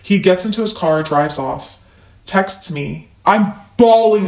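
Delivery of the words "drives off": ordinary talk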